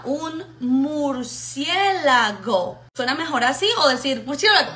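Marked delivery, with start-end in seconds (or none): 0.0-2.8 very slow "mur-cié-la-go", syllable by syllable; patient, spelled-out "murciélago"; broken into little pieces. Shown as a demonstration. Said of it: "te lo divide en pedacitos". 4.3-4.7 "murciélago" in one quick slurred blur; hurried, garbled "murciélago"; fast and run together. Shown as a demonstration. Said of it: "la dice rápido y lo pega todo".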